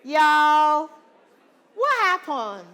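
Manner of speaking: loud call to the whole room. Real, not acted. Teacher calling out to the drama class, loud. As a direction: grab the class's attention.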